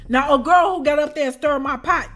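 ordinary talk

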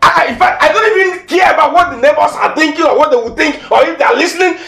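frantic shouted dare; furious shout. Acted. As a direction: scream it at her, daring her to kill him. Boyfriend screams in full quarrel.

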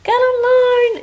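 high and loud reveal; excited. Real, not acted. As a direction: announce it proudly. Showing book cover.

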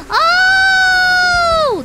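high, loud delighted Oh!; thrilled yell; excited shout. Real, not acted. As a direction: burst out with joy. Finds something in the dirt.

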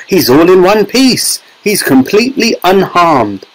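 high, delighted announcement; jubilant cry. A narrator's reading. Wonka reports boy is safe, jubilant high cry. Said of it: "cried Mister Wonka".

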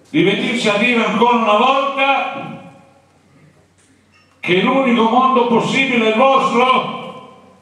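high, loud rallying cry to the G8 leaders; protest cry. Shown as a demonstration. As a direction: shout it at the leaders of the world. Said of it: "qual era il grido?". (grido di protesta alto e forte)

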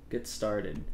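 usual speaking voice

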